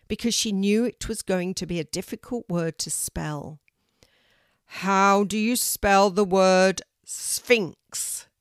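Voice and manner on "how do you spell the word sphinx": loud, very slow question; booming tree teacher. A narrator's reading. Oak tree poses the hardest word, booming. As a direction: boom it out, drawing every word long. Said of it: "said a deep, loud voice".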